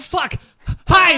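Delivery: high, very loud alarm; panicked shout. Acted. Character seeing a portal open in the sky, panicked shout. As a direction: shout it as an urgent warning.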